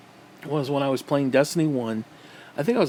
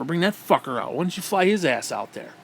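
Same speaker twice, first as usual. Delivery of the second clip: ordinary delivery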